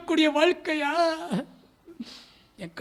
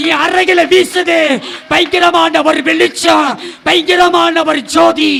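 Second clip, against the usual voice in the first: louder and louder preaching climax; full-force preaching; 6 dB louder than usual. Acted. Preacher crying out to the congregation, building to a shout. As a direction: build it into a thundering sermon cry.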